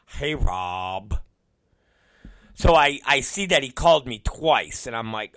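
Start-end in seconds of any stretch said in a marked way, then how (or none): 0.1-1.2 low, dopey mimicry; mocking employee impression. Unreliable employee greeting his boss, low mocking voice. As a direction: imitate a sheepish caller, voice dropped low.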